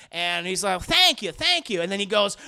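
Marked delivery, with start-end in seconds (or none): 0.8-1.8 higher, louder quick thanks; high mock thanks. Coach Cotton thanking the referee, high gushing thanks.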